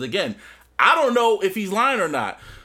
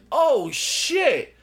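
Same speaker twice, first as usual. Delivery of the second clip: higher, drawn-out stunned reaction; mock shock; 6 semitones higher than usual. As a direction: let the shock sink in. Acting out Kane's shocked reaction.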